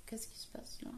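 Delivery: puzzled whisper. Real, not acted. Knitter spotting a problem on camera, whispered. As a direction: whisper it as you spot trouble. Notices a dropped stitch.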